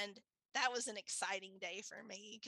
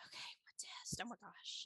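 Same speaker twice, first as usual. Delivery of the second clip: hushed, anxious whisper; retold anxiety; 10 dB quieter than usual. Told she will be tested, anxious whisper. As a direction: whisper it as nervous self-talk. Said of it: "I was really anxious".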